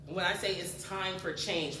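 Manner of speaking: ordinary talk, nothing marked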